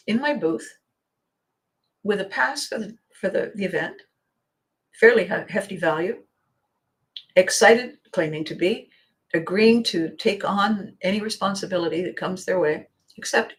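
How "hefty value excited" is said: ordinary delivery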